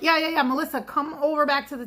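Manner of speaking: waving her back over; calling a customer back across the room; mock calling back.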